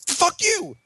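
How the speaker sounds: high, outraged fuck you; mock angry retort. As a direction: fire it back high at the computer. Retorting to the password checker.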